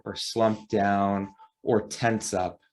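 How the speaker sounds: usual speaking voice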